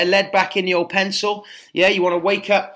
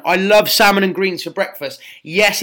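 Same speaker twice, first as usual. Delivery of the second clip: normal talking voice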